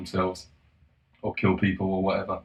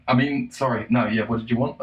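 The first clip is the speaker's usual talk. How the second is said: ordinary talk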